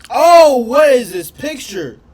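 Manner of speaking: loud, high oh then slow question; shocked. Real, not acted. Streamer reacts to harsh picture, loud shocked blurt.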